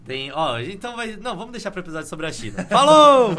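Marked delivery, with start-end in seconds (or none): 2.7-3.3 loud, high holler to close the show; big shouted farewell; shouted sign-off. Real, not acted. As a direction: belt out the goodbye, pitch way up. Calling out a podcast sign-off.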